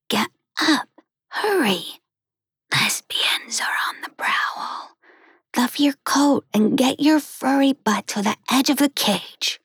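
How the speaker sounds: high, hushed and hurried; urgent kitten whisper. Acted. Kitten hurriedly whispers to wake her sleeping sister. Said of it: "and whispered".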